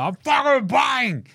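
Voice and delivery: high, drawn-out mock battle yell; hammed-up high yell; full-blown outburst. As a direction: throw it out like a wild swing. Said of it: "Like, full-blown, just like".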